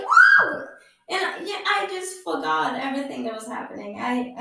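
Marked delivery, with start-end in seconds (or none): 0.0-0.7 very high, loud wow; amazed squeal. Retold or imitated. Traveller handed a book by new friend, amazed squeal. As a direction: squeal it in delighted astonishment.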